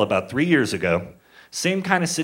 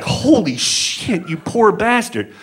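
slow, higher, shocked; stunned realization; 7 semitones higher, slower than usual. Retold or imitated. Comedian suddenly realizing who the guest is, stunned. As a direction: let it dawn on you in horror. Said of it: "I fucking snapped out of, like".

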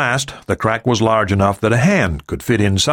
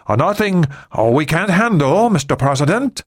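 stammering; 8 semitones higher than usual. A narrator's reading. Ri nervously explains himself to the President, stammering. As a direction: stumble over it, pitched up. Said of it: "he stammered".

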